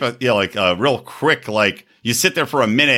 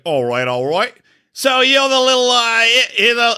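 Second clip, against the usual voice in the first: put-on high, loose British accent slipping into Aussie; mock British dad, rising in pitch; British bloke; 10 semitones higher, 3 dB louder than usual. Retelling a girlfriend's dad to co-hosts, British bloke accent. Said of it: "He was the epitome of the British bloke".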